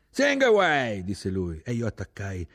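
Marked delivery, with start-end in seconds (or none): 0.1-1.0 high, loud holler; shouted go-ahead. A narrator's reading. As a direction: holler permission over the noise. Officer shouts back over engines.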